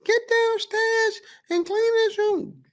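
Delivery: very high, slow shrieking call; mom yelling. Retold or imitated. A mother shouting for her son to clean his room, very high slow yell. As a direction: yell up the stairs at the kid. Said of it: "Who's most famous for yelling?".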